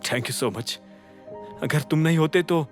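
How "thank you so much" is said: low, soft, emotional thank-you; quietly grateful, close to tears; understated choked-up gratitude; faltering thanks. A narrator's reading. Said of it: "अभिनव की आवाज लड़खड़ा रही थी".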